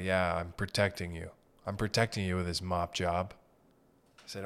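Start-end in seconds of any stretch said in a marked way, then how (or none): 0.0-3.3 low, unbothered mock janitor; deadpan janitor. Imitating the mopping guy who didn't flinch, low and flat. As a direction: drop the pitch, totally indifferent.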